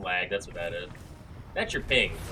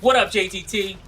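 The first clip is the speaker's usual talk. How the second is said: loud taunt; 10 semitones higher, 12 dB louder than usual. Real, not acted. Taunting a player.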